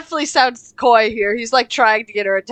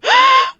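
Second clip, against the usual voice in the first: high sharp gasp; loud shocked gasp; 4 dB louder than usual. Real, not acted. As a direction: gasp in horror. Kyle vomits on floor.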